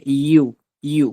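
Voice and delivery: very slow, stretched "ইউ"; lengthened U. Shown as a demonstration. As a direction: draw each "ইউ" out into a long yoo. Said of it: "ইউটা একটু লম্বা হবে".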